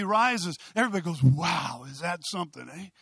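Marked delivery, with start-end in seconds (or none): 1.2-2.9 low-pitched imitation of impressed onlookers; mock awe. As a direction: play the easily impressed crowd, tongue in cheek. Mimics crowd impressed by new philosophy.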